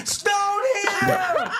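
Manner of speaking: high, loud mocking shout. Angry passengers yelling he's back, high shout. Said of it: "they're like".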